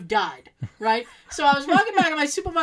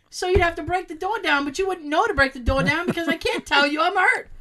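raised-pitch, mock-indignant arguing; worked-up protest; 6 semitones higher, 3 dB louder than usual. Arguing to the supervisor about being hurt, worked up. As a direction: build a hammed-up case of imagined peril.

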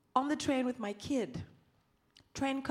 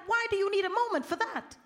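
laughing disbelief; 7 semitones higher, faster than usual. Retold or imitated. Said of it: "I was like".

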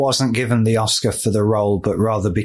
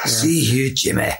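mock Connery accent at a brisker pace; horrific film accent; slower than usual. Echoing co-host's First Knight joke, mock Connery accent. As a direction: send up the bad accent in a short burst. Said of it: "That one was horrific".

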